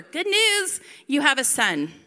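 loud, high mock-cheerful herald; cheery announcement. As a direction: proclaim the happy news loudly. Acts messenger announcing a birth.

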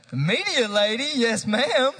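high, loud playful cheer; playful hollered praise. Real, not acted. Preacher calling out to the quick media lady, playfully excited. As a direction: holler it with exaggerated delight. Jokingly praising the slide operator.

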